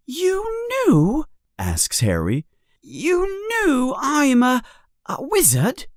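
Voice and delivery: put-on a boy asking, eager to know; young Harry. A narrator's reading. Harry voiced high.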